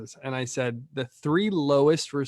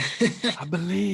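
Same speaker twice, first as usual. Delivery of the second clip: mock cheer; 6 semitones higher, much slower than usual. Mock-encouraging a guest.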